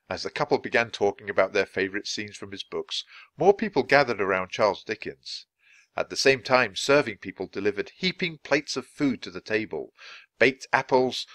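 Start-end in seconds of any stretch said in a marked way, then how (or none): none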